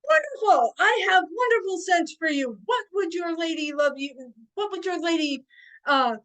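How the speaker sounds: high, loud, theatrical courtly hail; hammed-up faire vendor voice; grand merchant patter. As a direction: proclaim it to a lord, settling down at the end. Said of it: "And I'm like".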